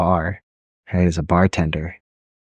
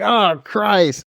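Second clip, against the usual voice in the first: high mock exasperated groan; higher mock exasperation; 11 semitones higher than usual. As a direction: let it out fed up, higher.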